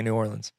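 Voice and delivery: "Orleans" said OR-linz, short ending; correct pronunciation. Shown as a demonstration. Co-host insists on own version, short OR-linz. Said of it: "I say it correctly".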